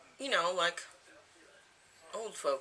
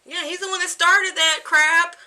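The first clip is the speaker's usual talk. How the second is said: much higher and louder jab; loud teasing; 13 semitones higher, 17 dB louder than usual. Real, not acted. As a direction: tease the son loudly. Teasing son about hair.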